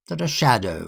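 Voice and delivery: ordinary delivery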